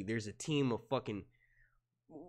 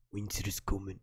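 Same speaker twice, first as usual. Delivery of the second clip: Jon Snow line; 6 semitones lower than usual. Pretend. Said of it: "'cause I'd be like".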